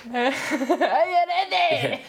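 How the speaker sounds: high, slow, giggling Scottish crowd call; laughing Scottish hype. Retold or imitated. Singer replaying her stage call for friends, in a laughing Scottish accent. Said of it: "Her accent was really good".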